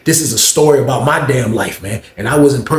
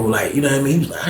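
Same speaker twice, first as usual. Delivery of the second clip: usual speaking voice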